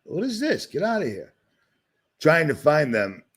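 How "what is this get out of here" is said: high, startled disbelief; a sudden "what is this?" in real surprise; surprised. Real, not acted. Surprised reaction to something on screen.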